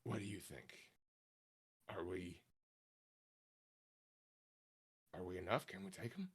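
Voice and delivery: put-on a slip into the wrong accent, asking quietly; the wrong accent for Dario, said quietly. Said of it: "that was the wrong accent".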